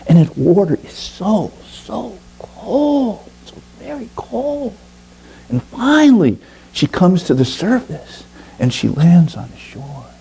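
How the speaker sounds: high, awed narration of the icy dive; rising storyteller awe. A narrator's reading. Narrator tells the bird's second dive, high and awed. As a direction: lift the voice high as she dives into the cold.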